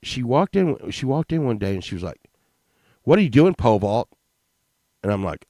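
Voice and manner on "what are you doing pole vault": mock yell. Angry teacher confronting the kid.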